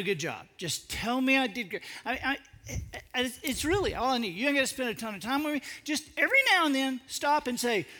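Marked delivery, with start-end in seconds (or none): 5.8-7.9 eager plea. Acted. Pleading for praise as a puppy.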